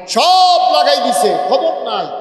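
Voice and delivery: dramatic build. Acted. Preacher builds the story dramatically.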